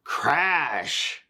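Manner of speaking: stretched storybook "crash"; big capital-letters crash. A narrator's reading. Reader voices the shell crashing downhill, drawn-out emphatic crash. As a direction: make the crash word a sound effect. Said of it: "crash in big capital letters".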